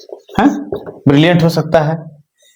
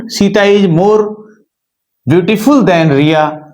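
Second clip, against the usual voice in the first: very slow, words spaced out; slow dictation; much slower than usual. Shown as a demonstration. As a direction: dictate the sentence end slowly. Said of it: "teacher dictating the example". (धीमे से लिखवाते हुए)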